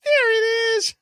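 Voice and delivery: squeaky high cartoon bird; high-pitched silly bird voice. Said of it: "the bird flying up and going".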